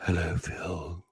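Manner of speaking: low, slow, drawn-out greeting; Linda impression. Co-host mimicking how Phil's mother talks, low drawn-out impression. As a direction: softly drawl a low hello. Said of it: "That's how she talks".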